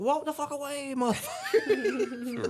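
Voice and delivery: high, drawn-out mock yell; high laughing yell. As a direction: stretch it out laughing. Yelling advice at friend.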